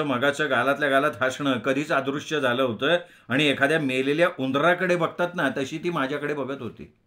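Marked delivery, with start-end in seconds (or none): none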